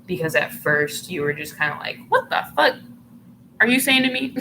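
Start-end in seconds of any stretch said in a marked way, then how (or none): 2.1-4.4 high, louder offended "you"; mock indignation. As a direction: act affronted in a raised, higher voice. Acting out the listener's offended reaction.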